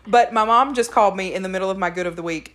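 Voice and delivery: ordinary talk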